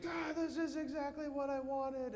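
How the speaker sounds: high squealing delight; excited kid. Retold or imitated. Priest voicing a kid opening gifts, high and thrilled. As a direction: squeal like a kid tearing open a gift.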